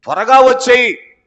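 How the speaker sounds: high, loud longing call; bride's cry. Retold or imitated. Bride church calls to the Lord, high loud plea. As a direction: call out eagerly for him to come. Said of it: "వధువు సంఘం ఏమంటది".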